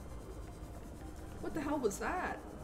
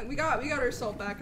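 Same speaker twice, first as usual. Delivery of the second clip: usual speaking voice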